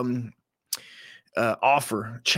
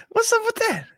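mock falsetto. Repeat of mock voice.

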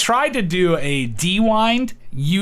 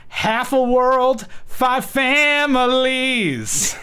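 slow drawn-out rating; 9 semitones higher, much slower than usual. Acted.

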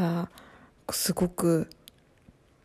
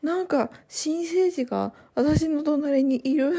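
higher, slower awed exclamation; amazed; 7 semitones higher, slower than usual. Retold or imitated. Mother on the delivery bed, slow amazed voice. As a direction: marvel at the newborn lying beside you. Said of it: "不思議な感覚になりましたね".

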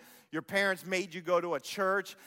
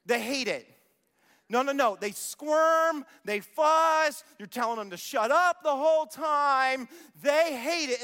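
louder, higher, pressing the point hard; raised voice with rising pitch; emphatic preaching; 6 semitones higher, 6 dB louder, slower than usual. Real, not acted. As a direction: hammer it home to the parents. Preacher insisting kids hate church.

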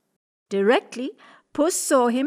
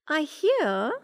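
high, sly cat voice; 6 semitones higher than usual. Acted. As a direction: flatter him like a cunning cat. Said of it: "she said".

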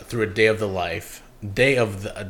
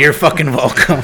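loud, pitched-up brag; big showy holler; mock boast; 6 semitones higher, 12 dB louder than usual. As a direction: crow it at the listeners, about to laugh. Mock-boasting, echoing co-host, then laughs.

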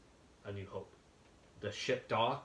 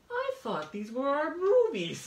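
high, loud mock-hurt plea; retold wounded protest; 11 semitones higher, 11 dB louder than usual. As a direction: protest to Dad in a raised, strained pitch. Child's hurt plea to dad, retold.

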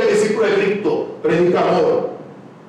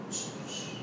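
breathy near-silent whisper; 22 dB quieter, slower than usual. Real, not acted. Preacher whispering to the congregation about themselves. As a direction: let it fall to a whisper.

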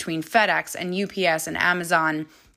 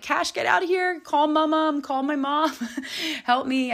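retold yell for help; 9 semitones higher than usual. Mom calls her son after a fall, high mock yell. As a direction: call out to your son in a pitched-up mock panic. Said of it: "I'm, like, yelling for my son".

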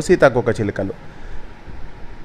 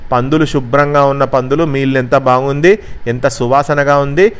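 ordinary delivery, nothing marked

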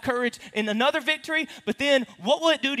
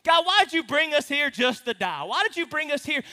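higher, louder despairing whine; whiny drama; 7 semitones higher, 4 dB louder than usual. Acted. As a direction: wail it like a drama queen. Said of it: "Do you hear in his voice?".

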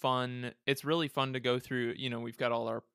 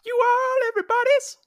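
high silly voice; 11 dB louder than usual. Acted. Mimicking a commercial line.

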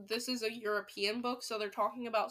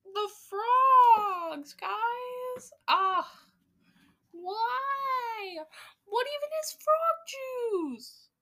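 high, wailing outrage for the frogs; anguished disbelief; 11 semitones higher, 7 dB louder, much slower than usual. Real, not acted. Reader crying out to listeners over the frog juice, high and wailing. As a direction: cry out in exaggerated dismay, slowing at the end.

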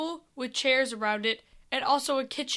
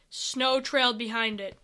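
ordinary delivery

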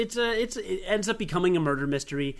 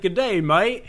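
Australian greeting; 4 semitones higher, 6 dB louder than usual. Shown as a demonstration. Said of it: "I could say, like".

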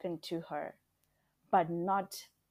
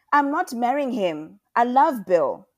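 high, loud refusal; defiant outburst; 6 semitones higher, 12 dB louder than usual. A narrator's reading. Daughter refuses forced marriage to her mother, defiant outburst. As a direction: throw it back at her, full voice.

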